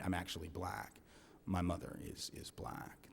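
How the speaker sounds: low and soft, almost breathed; earnest quiet confession. Retold or imitated. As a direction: sell the lie with a hushed sincere admission. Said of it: "This is the part I had to practice in the mirror".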